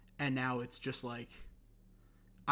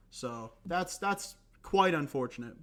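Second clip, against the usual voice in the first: ordinary talk, nothing marked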